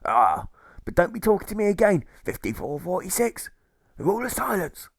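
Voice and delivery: put-on a hearty old sailor type brushing him off; jailer's gruff reply. A narrator's reading. Jailer scolding prisoner.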